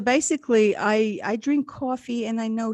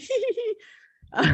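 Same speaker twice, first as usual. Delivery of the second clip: high-pitched mock tittering; put-on squeaky giggle; acted giggle. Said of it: "And I'm going".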